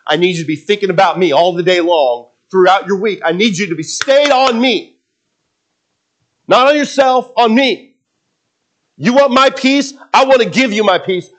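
high, emphatic preaching as God; insistent God. Acted. Preacher voices God offering peace to believers, insistent and high. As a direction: urge the listener as God would, stressing "me".

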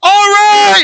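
high, loud shout; yelling over the plane. Retold or imitated. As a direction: yell it over the roar of the engine. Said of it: "they're yelling at the top of their lungs".

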